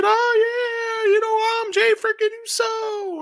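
mock boastful wrestler, high and smug; cocky Jey Uso. Mimicking Uso strutting, boasting.

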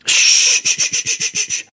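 hvæsende shh-lyde; dæmp børnene hurtigt; tyssen. A narrator's reading. (hvislende tyssen)

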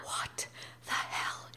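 slow, hushed mutter to herself; retelling a stunned, slow whisper to herself in the bathroom; whispered disbelief. Retold or imitated. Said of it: "just going".

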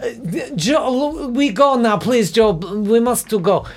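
high, halting fake Ukrainian voice; bad Ukrainian accent. Urging brother away without breaking character, bad Ukrainian accent. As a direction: plead haltingly in a badly faked Ukrainian accent. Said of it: "bad Ukrainian accent, having to go".

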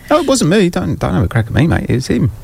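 mock protest. To co-host, blaming the other presenter, high mock innocence. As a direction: playfully shift the blame up high. Jokingly deflecting blame high.

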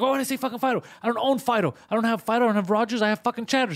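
high, loud retold yelling; furious rant. Neighbor yelling over the wrong phone card, furious. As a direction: rant at him, fed up.

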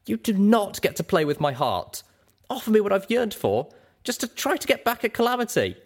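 high, cutting angry voice; cold and sharp. A narrator's reading. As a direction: reprimand her coldly and sharply. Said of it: "my voice cold and sharp".

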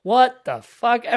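slow, loud mock outburst; hammed-up shocked outburst; mock outrage. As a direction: drag it out in fake shock. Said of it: "It's like".

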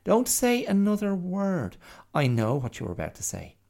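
loud high cut-off, then rushed; quick interjection. Acted. Bear interjecting to cut Mia off, loud and fast. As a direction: jump in and stop her. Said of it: "The bear quickly interjected".

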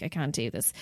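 soft, beaten-down murmur; defeated quiet quote. Retold or imitated. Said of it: "and he was like".

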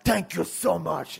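high, loud, slow gushing thanks; overflowing with gratitude; grateful inmate. Retold or imitated. Inmate thanking the barber for the haircut, high gushing thanks. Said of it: "He goes".

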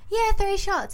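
high voice, yeah, three shots; chirpy high reply; high-pitched confirming. Retold or imitated. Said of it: "I'm like".

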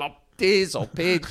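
slow, loud, drawn-out fed-up sigh of the names; exasperated groan. Retold or imitated. Warnie finding another beer on his seat, exasperated drawl. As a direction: sound worn out by the same joke. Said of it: "he's gone".

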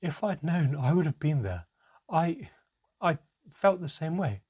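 sobbing. Acted. Mother sobbing out her apology to Manka, tearful. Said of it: "My mother was sobbing now".

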